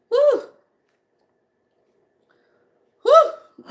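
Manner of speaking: high, loud whooping cheer; excited whoop. Real, not acted. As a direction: let out a joyful woo. Whooping as energy hits.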